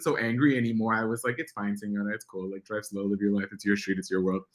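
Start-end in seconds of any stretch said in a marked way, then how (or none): 1.4-4.4 much softer, easygoing; calm letting go. Retold or imitated. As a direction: let it go gently, no anger left. Calming down about the slow driver.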